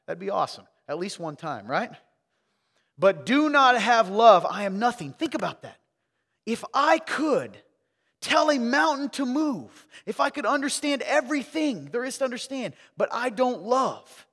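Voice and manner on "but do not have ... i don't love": raised pulpit voice, weighty words; forceful preaching. Acted.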